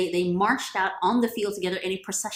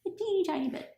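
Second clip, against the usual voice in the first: much higher, small squeaky voice; tiny voice; 12 semitones higher than usual. A narrator's reading. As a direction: shrink the voice to show how tiny. Narrator stressing smallness of fear.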